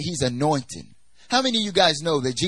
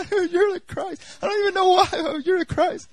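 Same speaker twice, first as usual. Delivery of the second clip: high-pitched, wailing mock sob; re-enacted crying; 3 dB louder than usual. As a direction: cry it out in a very high voice. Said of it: "I don't even know why I'm crying".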